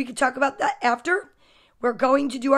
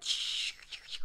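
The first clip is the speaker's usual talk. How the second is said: soft, voiceless shushing; imitated parents' shushing; 15 dB quieter than usual. Parents covering kids' ears, imitated soft shushing. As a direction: hush softly like a protective parent. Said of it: "you parents out there are like".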